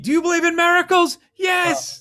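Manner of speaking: high, loud announcer cry; imitating an announcer's miracle call; famous excited call. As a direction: belt it like the legendary call. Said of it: "saying something that people repeat".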